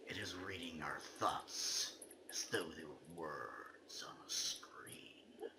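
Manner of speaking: hushed, secretive whisper; spooky whisper. Acted. Reading the game's ending poem aloud, in a whisper. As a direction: breathe it like a secret.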